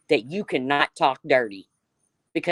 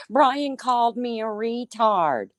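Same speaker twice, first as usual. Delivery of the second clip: slow, higher whiny mimicry of her; mock whine; 5 semitones higher, slower than usual. Streamer mimicking Sin's complaint in Randy's chat, slow whiny mimicry. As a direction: mimic her tattling, drawn out. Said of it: "ran into Randy's chat and said".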